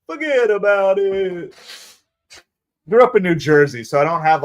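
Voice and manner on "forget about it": drawn-out New York "forget about it", slow; slow, mild New York accent. Pretend. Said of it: "the real New Yorker accent".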